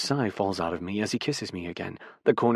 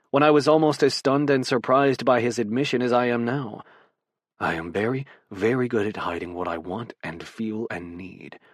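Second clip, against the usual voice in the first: plain reading voice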